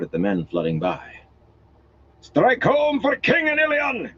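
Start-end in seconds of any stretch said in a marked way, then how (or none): 2.2-4.1 high, slow battle cry; rally the troops; shouted. A narrator's reading. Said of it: "he shouted".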